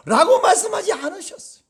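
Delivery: high laughing aside. Real, not acted. Preacher laughing off the mock quote, amused. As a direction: laugh it off, pitch up.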